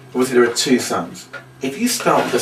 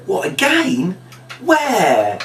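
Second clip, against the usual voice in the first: mock surprise; 10 semitones higher, much slower than usual. Shown as a demonstration. Hearing the children scream again, high mock astonishment. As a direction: act astonished. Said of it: "And then you say".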